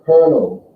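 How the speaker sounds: "Kernel" said clearly with the "er" vowel; teacher models "Kernel"; word demo. Shown as a demonstration. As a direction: model "Kernel" slowly for students. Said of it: "in the word kernel almost".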